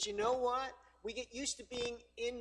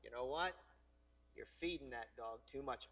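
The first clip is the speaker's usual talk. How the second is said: frank remark; 8 semitones lower, 7 dB quieter than usual. Retold or imitated. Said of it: "And I'm like".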